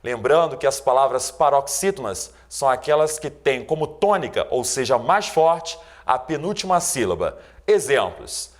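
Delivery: ordinary talk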